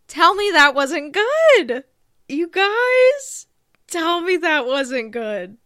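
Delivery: excited. Real, not acted. Excited after her impression.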